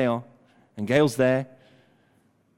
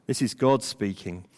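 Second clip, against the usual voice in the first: ordinary talk, nothing marked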